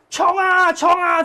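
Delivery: high, loud yelling of "冲啊"; 阿嬷在云霄飞车上兴奋大喊; shouted cheer. Retold or imitated. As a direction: yell it like a thrilled grandma on a rollercoaster. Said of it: "就一直喊".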